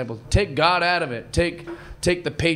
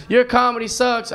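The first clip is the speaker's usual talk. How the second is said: higher-pitched acted jibe; mock lady heckler, moderately voiced; heckler's complaint; 6 semitones higher, 3 dB louder than usual. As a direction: heckle without shouting. Acted heckler, first milder take.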